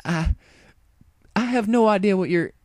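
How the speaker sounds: high, flustered cutoff; cornered stammer. A narrator's reading. Applejack cornered by Rainbow, high and flustered. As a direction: scramble for words.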